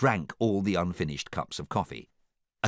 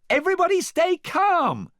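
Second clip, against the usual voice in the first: very high, loud shriek; frantic princess squeal; panicked cry; 8 dB louder than usual. A narrator's reading. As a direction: shout for calm while panicking.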